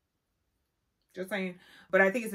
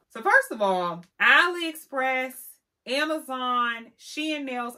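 loud, punchy list of shops; rising emphatic rundown; 4 semitones higher, 9 dB louder, slower than usual. Real, not acted. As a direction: hit each name hard and slow.

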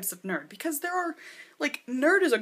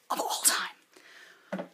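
slow, intense whisper; breathless whisper; 5 dB quieter, much slower than usual. Real, not acted. Fan whispering to viewers how much she loves the film. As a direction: hush it like a sacred vow. Gushing echo of favourite.

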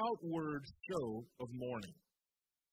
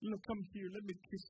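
mock coddling; 2 semitones lower, 6 dB quieter than usual. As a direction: coo like soothing a hurt child. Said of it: "God hasn't come and coddled them and said".

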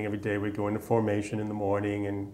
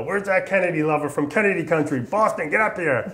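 mock drill sergeant calling out across the company, much higher and louder; bellowing sergeant; 7 semitones higher, 4 dB louder than usual. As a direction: summon the recruit like a rough Southern sergeant. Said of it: "is real Southern, real hardcore, gravelly voice, loud".

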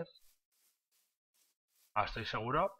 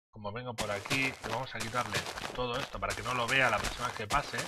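normal talking voice

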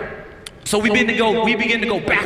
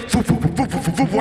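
rhythmic puffed "foo" bursts; imitated ride noise; 3 semitones lower, faster than usual. Describing the wavy bit at the ride's end, imitating it. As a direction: puff out the bumps of a wavy track. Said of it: "wavy thing that goes".

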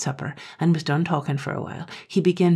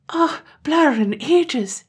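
dismayed wail; 10 semitones higher, 3 dB louder, much slower than usual. Acted. Terence learns gander is father.

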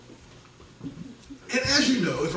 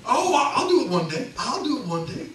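high, loud, laughing dismissive promise; mock wife brushing off; 10 semitones higher, 6 dB louder than usual. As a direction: tease a wife putting it off with a laugh. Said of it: "If my wife says to me".

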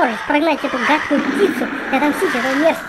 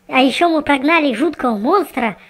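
usual speaking voice

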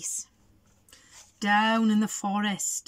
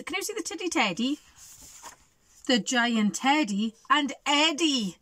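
ordinary delivery

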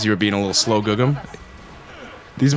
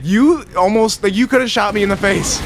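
mad; 8 semitones higher, 4 dB louder than usual. Retold or imitated. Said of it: "I would still be mad".

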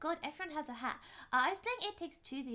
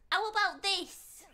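loud, high, drawn-out British accent; hammed-up British; 6 semitones higher, 5 dB louder, slower than usual. Shown as a demonstration. Streamer offering another style, exaggerated British accent. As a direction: lay the British on thick. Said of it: "go ham on the British accent".

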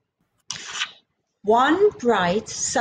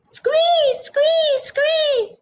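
high shrill scree; bird squawk; 3 dB louder, much slower than usual. Acted. Startled jungle bird squawks as the tail comes down. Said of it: "the bird squawked".

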